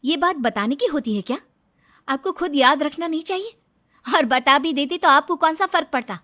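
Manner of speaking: loud, resentful wife's rebuke; angry scolding. Acted. Wife scolds husband over birthday.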